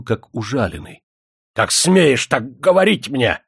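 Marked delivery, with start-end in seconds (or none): 1.5-3.4 high, loud, slow furious shout; angry shout. A narrator's reading. Offended Demidov shouts at the girl's father, furious. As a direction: roar at the insolent old man. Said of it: "закричал он".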